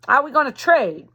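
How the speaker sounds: shouted question across the river; calling back. Acted. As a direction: call out to the Reb over the water. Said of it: "Charlie called back".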